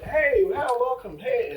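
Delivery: high-pitched, enthusiastic mock greeting; excited welcome. Winery regulars welcome the podcasters back, acted excited greeting. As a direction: imitate regulars hailing returning friends.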